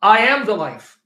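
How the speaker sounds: "am" punched hard and loud; insisting loudly that Jesus claims to be the life; emphatic loud quote. Retold or imitated. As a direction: declare it with force.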